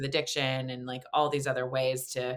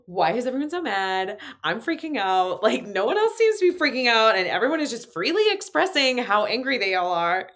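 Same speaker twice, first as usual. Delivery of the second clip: high, loud, panicky quote; freaking out kid; 8 semitones higher, 8 dB louder than usual. Retold or imitated. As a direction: relive the child's panic, pitched up and loud. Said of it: "And I was just like".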